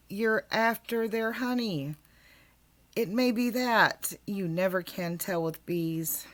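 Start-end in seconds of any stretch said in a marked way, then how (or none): none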